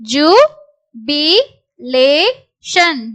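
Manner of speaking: slow syllables with gaps between. Shown as a demonstration. Teacher sounds out a word for learners, syllable by syllable. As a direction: break "Jubilation" into four separate syllables.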